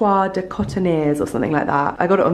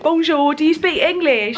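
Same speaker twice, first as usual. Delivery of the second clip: mock tourist; 10 semitones higher, 4 dB louder than usual. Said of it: "that girl who's like".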